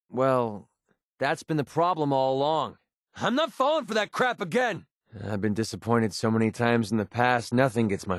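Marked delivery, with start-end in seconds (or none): 3.2-4.9 high-pitched, indignant outburst; angry refusal. Acted. Refusing to fall for a trick, angry.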